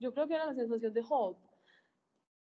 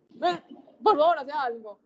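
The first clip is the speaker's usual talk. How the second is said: pleading demand; 4 semitones higher, 6 dB louder than usual. Retold or imitated. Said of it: "y uno es como que".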